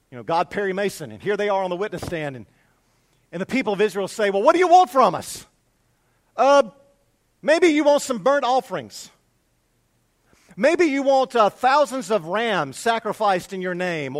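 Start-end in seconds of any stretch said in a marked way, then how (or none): 4.3-14.2 loud, higher-pitched mock protest; exasperated retort. People of Israel on the witness stand, loud exasperated retort. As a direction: act out indignant defendants answering back.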